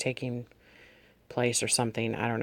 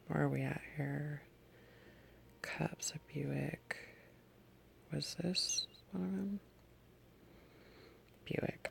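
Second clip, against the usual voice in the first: very soft, near-whispered self-talk; muttering to self; 18 dB quieter than usual. Real, not acted. Checking the notebook for the right dream, muttering to self. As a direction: think aloud under your breath. Searching her notebook, talking to herself.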